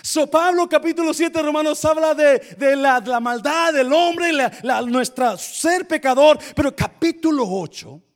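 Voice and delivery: loud, high preaching voice; fiery preaching. Real, not acted. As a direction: drive the point home loudly. Preaching at full force.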